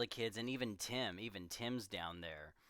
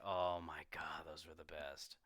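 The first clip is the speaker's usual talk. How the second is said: whisper; 4 semitones lower than usual. Real, not acted. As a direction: whisper it like a secret treat. Fond memory of Nutty Buddies.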